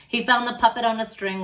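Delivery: ordinary delivery, nothing marked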